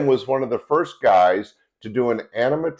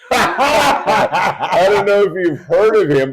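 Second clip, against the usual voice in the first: higher, louder, laughing through the words; laughing self-correction; 7 semitones higher, 5 dB louder, slower than usual. Real, not acted. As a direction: correct your slip while cracking up. Corrects own slip amid laughter.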